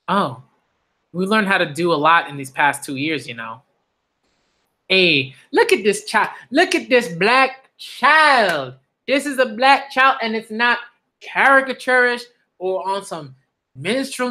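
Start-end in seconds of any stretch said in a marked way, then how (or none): 4.9-10.2 delighted emphasis. Real, not acted. Delighted by a character design.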